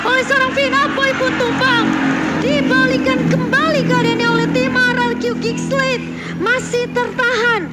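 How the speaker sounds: high, stretched hype calls; excited play-by-play. Real, not acted. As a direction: call the comeback fight live. Caster calls the turnaround fight.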